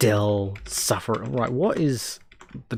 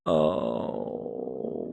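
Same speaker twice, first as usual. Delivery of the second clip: mock cry; 11 semitones lower, 5 dB quieter than usual. Fake cry.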